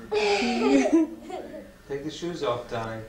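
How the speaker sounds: slow, laughing repeat of the order. Real, not acted. Laughing as she tells the darling to take his shoes off. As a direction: laugh it out, slowly drawn.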